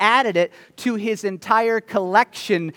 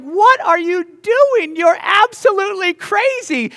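shocked neighbors; 12 semitones higher, 4 dB louder than usual. Pretend.

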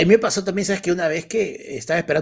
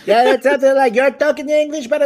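put-on Indian English accent; 9 semitones higher, 8 dB louder than usual. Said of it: "les jala el acentito".

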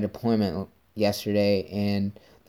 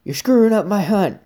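higher, louder imitated hunter telling him off; a lightly voiced cross hunter; annoyed hunter; 7 semitones higher, 5 dB louder than usual. Hunter calling out from the middle of the woods, mock annoyed voice.

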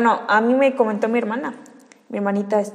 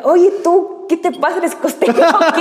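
higher-pitched mock questioning, laughter creeping in; university classmates teasing; 7 semitones higher than usual. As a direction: imitate a classmate's teasing challenge, half laughing. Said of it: "me lo decían en la universidad". (imitando con risa a un compañero burlón, voz más aguda)

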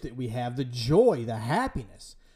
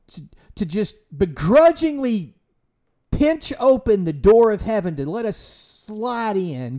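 louder, hammering preacher's warning; fiery preaching; 5 semitones higher, 5 dB louder than usual. Real, not acted. Preacher warning his congregation, loud and forceful. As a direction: drive it home from the pulpit.

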